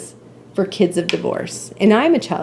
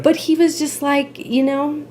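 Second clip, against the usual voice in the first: high voice, close to crying; holding back tears; 6 semitones higher than usual. Real, not acted. Mother retelling her son's words, trying not to cry. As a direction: push on with the story through rising tears. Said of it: "trying not to cry now".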